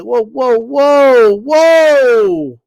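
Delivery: mock alarm. Real, not acted. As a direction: back off in mock shock. Reacting to the new persona.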